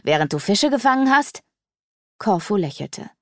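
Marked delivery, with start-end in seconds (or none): 0.0-1.4 higher, louder sneering question; scornful teasing jab; mocking. A narrator's reading. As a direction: needle him about his fishing. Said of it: "spettelte Sarana".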